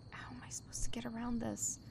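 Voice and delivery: nervous whisper. Real, not acted. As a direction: whisper so they won't hear. Sneaking past patrolling enemies.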